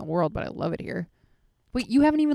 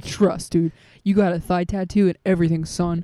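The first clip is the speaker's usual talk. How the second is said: loud mock bro pep talk; egging-on bro; 7 dB louder than usual. Co-host playing the man's friend egging him on, mock bro voice. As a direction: hype up your buddy, loud. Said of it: "his friend was like".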